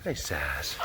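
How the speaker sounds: soft greeting. Real, not acted. Cooing to the pet.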